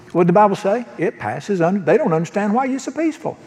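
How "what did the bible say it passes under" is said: ordinary delivery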